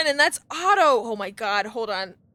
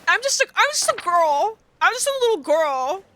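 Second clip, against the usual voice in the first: mock little girl; 6 semitones higher than usual.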